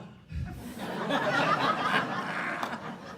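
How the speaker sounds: laugh. Real, not acted. Audience-like laugh at punchline.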